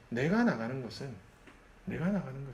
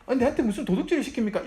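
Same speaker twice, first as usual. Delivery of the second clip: higher, louder mock protest at the boss; indignant retort; 10 semitones higher, 6 dB louder, faster than usual. Employee pushing back at a boss, mock indignant. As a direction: snap back as if wronged. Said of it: "이렇게 싸우면 안 된다고요".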